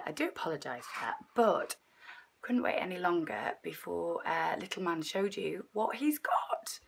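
whispering with a lost voice; hushed, voiceless whisper; raspy whisper. Real, not acted. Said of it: "I'm losing my voice".

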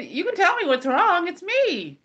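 high, pleading reassurance; gently urging her aunt to open up; coaxing concern. Retold or imitated. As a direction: coax the aunt to talk. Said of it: "So I said".

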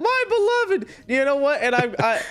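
grieving yell over his horse; mock wail. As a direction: wail for the lost horse, overdone. Wails for dead horse.

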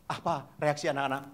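ordinary talk, nothing marked

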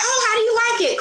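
very high friendly question; light mock coffee-shop chatter; cheery small talk. Shown as a demonstration. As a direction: chat up a stranger. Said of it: "And I'm like".

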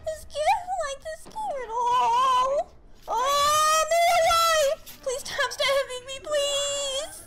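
frantic crying and screaming, high; sobbing panic, begging it to stop; angry crying. Real, not acted. Screaming while attacked in horror game.

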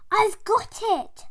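sudden idea, called out; excited cry. Acted. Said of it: "she cried".

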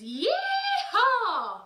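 loud, high, drawn-out cowboy yeehaw. Shown as a demonstration. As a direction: belt it out like a cowboy. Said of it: "the first noise that we're going to make".

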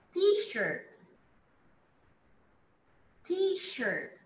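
T-shirt lifted high; model word high. Shown as a demonstration. Teacher models T-shirt high for class. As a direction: lift T-shirt high and clear. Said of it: "model word".

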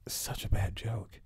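a breathy whisper, dismayed at the joke; whispered aside. Real, not acted. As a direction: drop to a whisper, shaking your head at it. Aside in disbelief at the film.

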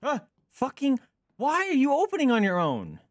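high, loud jolt of fright and annoyance; real startled outburst. Real, not acted. Startled by something opening by itself.

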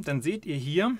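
ordinary delivery

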